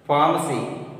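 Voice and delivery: ordinary talk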